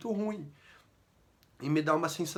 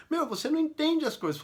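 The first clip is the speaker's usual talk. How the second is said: high, dismissive retort, pitched up; wife's retort; 12 semitones higher than usual. Retold or imitated. Wife brushing off her husband in an argument, dismissive. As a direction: toss it off like she's fed up with him.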